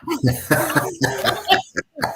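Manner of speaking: loud, sustained laugh; hearty laughter. Real, not acted. Host finishing her insurance story, loud real laughter. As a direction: burst out laughing at the punchline.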